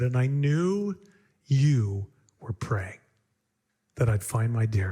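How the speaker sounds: retold tearful confession, low and slow. Hunter tells his friend he knew he was praying, tearful. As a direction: imitate a man moved to tears, voice dropped low. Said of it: "looked at me with tears in his eyes".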